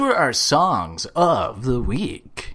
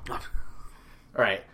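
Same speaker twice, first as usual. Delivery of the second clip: usual speaking voice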